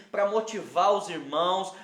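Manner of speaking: usual speaking voice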